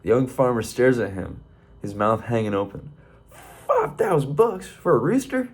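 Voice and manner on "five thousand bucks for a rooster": much higher, squeaky, incredulous question; shocked disbelief. Acted. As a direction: shriek it up in total disbelief. Young farmer shocked at price.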